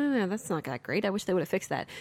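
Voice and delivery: mild grumble. Retold or imitated. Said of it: "I might say".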